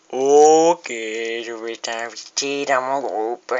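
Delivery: slow drawn out. Pretend. Mock-grand invitation in game.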